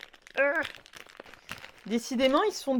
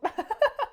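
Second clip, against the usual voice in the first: laugh; 10 semitones higher than usual. Real, not acted. Laughing at spilled jelly drink.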